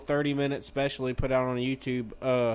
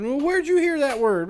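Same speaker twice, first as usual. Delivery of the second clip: agitated dad; 10 semitones higher, 4 dB louder than usual. Retold or imitated. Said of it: "his best friend's dad".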